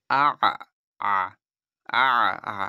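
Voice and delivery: আ voiced full and loud from the throat, each one separate; clear letter demo. Shown as a demonstration. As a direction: sound out every আ distinctly, teacher-style. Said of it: "উচ্চারণটা করুন ভালোভাবে". (প্রতিটি আ স্পষ্ট ও জোরে উচ্চারণ)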